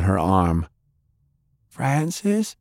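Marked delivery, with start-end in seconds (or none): 1.7-2.6 put-on a young girl's voice, higher, unsure, rising into the dark; frightened girl calling out. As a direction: call his name, scared and small. Faye calls out in the dark.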